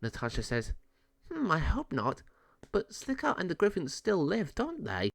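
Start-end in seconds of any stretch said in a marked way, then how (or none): 1.3-5.1 higher voice, a touch of unease; a fairy mildly uneasy about old enemies; lightly voiced worried fairy.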